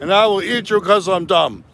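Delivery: booming high storybook giant. Acted. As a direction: bellow it like a fairy-tale giant. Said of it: "[name] had the best voice for the giant".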